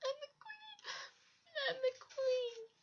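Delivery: very high, slow, broken sob; a dethroned queen crying into her friend's shoulder; sobbing. A narrator's reading. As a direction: weep it, voice cracking high. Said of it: "she cried into Froggy's head".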